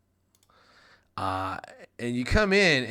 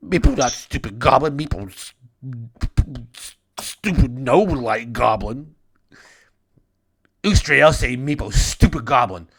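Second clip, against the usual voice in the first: weeping kobold; 5 semitones higher, 10 dB louder than usual. Acted. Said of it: "Meepo starts weeping again".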